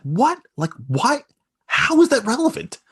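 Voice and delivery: shrill disbelief, almost yelling; incredulous outburst. Retold or imitated. Son can't believe the stranger's questions, incredulous yell.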